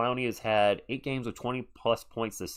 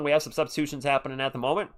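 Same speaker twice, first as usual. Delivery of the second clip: ordinary talk, nothing marked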